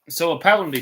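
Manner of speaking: talking with a mouth full of food; words round a bite of food. Real, not acted. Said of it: "I better not speak with my mouth full".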